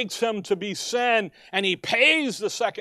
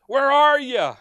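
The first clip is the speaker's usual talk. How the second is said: loud, higher cry; a loud, raised plea to an absent Father; anguished call; 5 semitones higher, 7 dB louder than usual. Acted. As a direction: call out into silence. Voicing Christ calling the Father.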